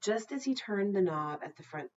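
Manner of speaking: ordinary delivery, nothing marked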